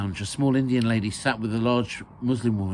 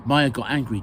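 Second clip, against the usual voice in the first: ordinary delivery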